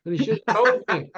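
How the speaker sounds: ordinary delivery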